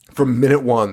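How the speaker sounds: ordinary talk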